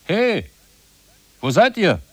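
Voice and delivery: loud high call into the distance. Acted. The narrator calling after the vanished lovers, loud call. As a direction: shout for them across the crowd.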